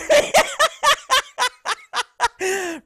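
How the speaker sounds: big high laughing fit; helpless laughter. Real, not acted. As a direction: let out a high burst of laughter. Reacting to clip.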